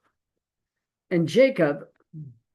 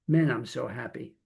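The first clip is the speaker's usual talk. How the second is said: low, subdued deadpan, imitating a friend; joyless claim of happiness; 4 semitones lower, 5 dB quieter than usual. Friend claims he is happy while driving, deadpan. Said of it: "I didn't hear it in his voice".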